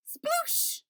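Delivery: high breathy sploosh; whispered splash sound. Acted.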